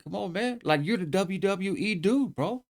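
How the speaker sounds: high, slow hype-man coaxing; hyping him up. Retold or imitated. Hyping up homeboy.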